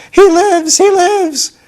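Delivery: high, loud ecstatic shriek; screamed. Acted. Witch Mombi exulting over the living pumpkin man, shrieking. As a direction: scream it out in wild delight. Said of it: "she screamed".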